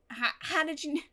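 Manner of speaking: usual speaking voice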